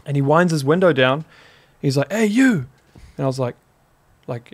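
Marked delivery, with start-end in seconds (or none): 2.1-2.7 high-pitched hailing call from a truck; retold call-out. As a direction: imitate a driver calling out. Truck driver calling out to him.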